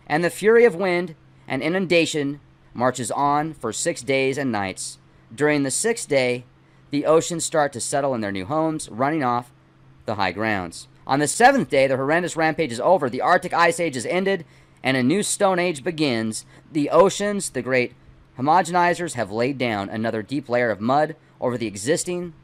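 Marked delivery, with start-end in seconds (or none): none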